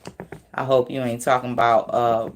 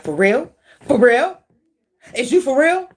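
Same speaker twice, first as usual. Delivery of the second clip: higher, louder incredulous challenge, rising each time; upset disbelief; 10 semitones higher, 6 dB louder than usual. Acted. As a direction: act out someone upset and incredulous. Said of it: "Somebody upset".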